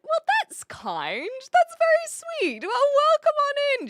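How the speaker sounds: high, loud, gushing delight; effusive welcome. Real, not acted. Streamer welcomes a new online friend, effusive.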